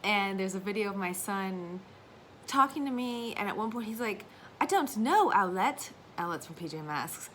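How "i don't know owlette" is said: put-on lightly British little boy, rising; a hint of a British child's accent; mimicking son's British accent; British toddler. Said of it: "one hundred percent British".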